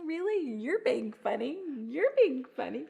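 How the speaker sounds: put-on teasing the baby. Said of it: "And then".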